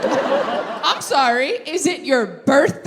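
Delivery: slow, loaded sarcastic question; mock indignation. Introvert to partner wanting guests, slow indignant sarcasm. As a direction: draw it out in disbelief.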